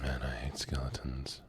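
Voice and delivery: soft whispered grumble to herself. Real, not acted. Streamer grumbling to herself about skeletons, whispered. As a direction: breathe it out under your breath, annoyed.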